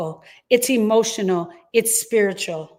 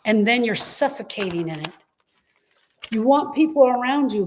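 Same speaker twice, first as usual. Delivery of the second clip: usual speaking voice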